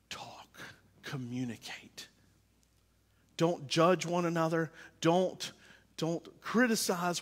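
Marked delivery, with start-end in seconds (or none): 0.1-2.1 whispered, word by word; hushed appeal. Real, not acted. Pastor urging families to put away fear, whispered plea. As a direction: drop to a whisper for emphasis.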